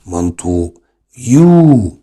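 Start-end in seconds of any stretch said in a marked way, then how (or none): 1.2-2.0 يو drawn out long, high and loud; long yoo sound. Shown as a demonstration. Teacher to students, showing the long sound in يو. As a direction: stretch يو into a long clear yoo. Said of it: "اليو هنا نطقها طويل".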